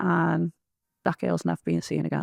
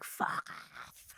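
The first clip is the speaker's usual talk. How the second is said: voiceless hissed fuck off; retold outburst, voiceless under the breath; whispered curse; 14 dB quieter than usual. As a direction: spit it out under your breath. Losing it at the man.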